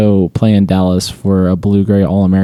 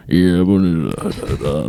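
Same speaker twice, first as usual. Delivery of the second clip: Southern drawl; 2 semitones lower than usual. Retold or imitated. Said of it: "Southern drawl".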